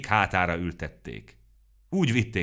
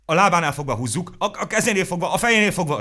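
high, loud, excited shrieks; high flustered shouting; shrieking; 11 semitones higher, 8 dB louder, faster than usual. A narrator's reading. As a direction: screech the ideas over each other.

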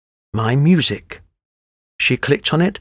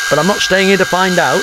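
slightly alarmed man, pitched up; a touch of panic; frightened workman replying; 5 semitones higher, 3 dB louder than usual. A narrator's reading. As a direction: blurt it as if ready to run. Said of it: "replied Bert".

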